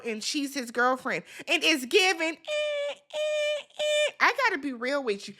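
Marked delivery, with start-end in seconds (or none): 2.4-4.1 three high, separate "eh" noises; high-pitched mock alarm noise; imitated high squeal. Imitates a noise after "it's giving.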